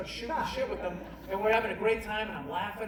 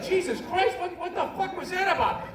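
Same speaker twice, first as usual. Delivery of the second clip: shocked; 12 semitones higher, 4 dB louder than usual. Retold or imitated. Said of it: "my buddy's like".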